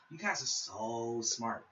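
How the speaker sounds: slow, mocking praise; sarcastic. Real, not acted. Ranting vlogger mocks know-it-all viewers, slow sarcastic drawl. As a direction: drag it out sarcastically.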